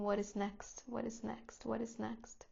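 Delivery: softly repeated thought, fading away; murmured inner thought. Retold or imitated. Teacher imitating a busy mind's to-do worry, softly murmured.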